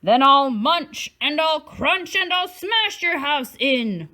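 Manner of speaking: shark roar. Acted. Shark threatening the three fish, loud mock roar. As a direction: bellow the threat. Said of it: "roared the shark".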